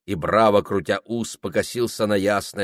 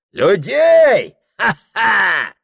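high shouted scoff; scornful loud laugh; 12 semitones higher, 8 dB louder, much slower than usual. Acted. Host scoffing at his wife's reproach, loudly mocking. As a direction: throw it back with a loud ha-ha.

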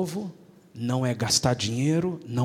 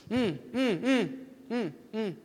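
put-on mumbling child; 6 semitones higher, 4 dB quieter, slower than usual. Child mumbling instead of asking.

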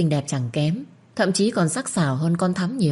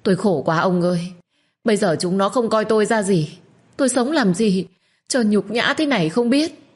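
whimpering complaint; 5 semitones higher than usual. Acted. Wife whining to her husband, a touch tearful. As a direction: lay on the self-pity, near tears. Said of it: "cứ khóc thút thít".

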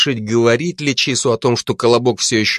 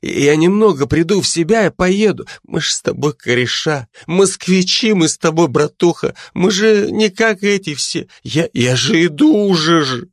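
put-on drunk pleading; 8 semitones higher than usual. A narrator's reading. Пьяный Колобок уговаривает друга.

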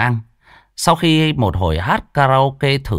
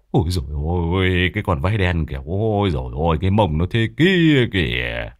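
gossiping bald man; 6 semitones lower than usual. A narrator's reading. Bald neighbour gossiping about the two women, low. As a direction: gossip low, as if behind their backs. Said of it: "Lão hói buôn chuyện".

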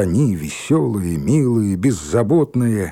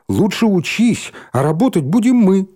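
high mock parent voice; quoting parents; 9 semitones higher, 4 dB louder than usual. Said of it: "Они говорят".

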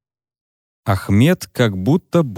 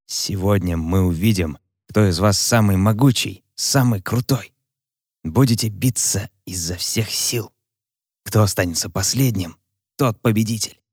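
mustache twirling villain. Acted. Senior hazing the new boys.

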